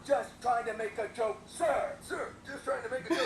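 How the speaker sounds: shouting military reply; loud gung-ho soldier. Acted. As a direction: bark it at attention. Private repeats louder, sergeant style.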